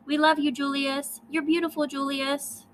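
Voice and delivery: put-on high, sing-song baby talk; cooing at baby. Parents coo high praise over baby Julius.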